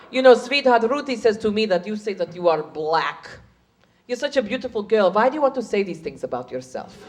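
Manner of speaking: put-on soft, condescending Hungarian accent. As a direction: correct her gently in a Hungarian accent.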